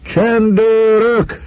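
very high, long-drawn call; shouting. A narrator's reading. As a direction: holler to the police from afar. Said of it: "kiabált Schwarz úr a vízben". (elnyújtott, magas kiáltás)

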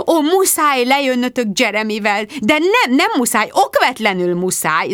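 high, loud, gushing babble; bubbling over. A narrator's reading. Rebecca bubbling over with invitations to Jude. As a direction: babble on breathlessly. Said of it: "zubogott Rebecca".

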